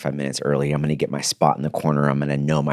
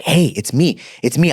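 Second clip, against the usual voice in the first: eager, higher voice bursting with joy; a long-lost dad who can't contain himself; thrilled; 8 semitones higher, 3 dB louder than usual. Retold or imitated. Father calling his son, thrilled and high.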